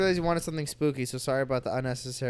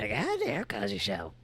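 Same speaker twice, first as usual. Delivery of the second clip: grandma voice; 3 semitones higher, 5 dB quieter than usual. Acted. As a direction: play a sassy old grandma. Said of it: "in the grandma voice".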